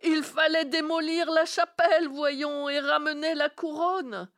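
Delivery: loud exasperated tirade; angry scolding. Acted. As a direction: berate them for missing the obvious. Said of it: "hurla-t-elle".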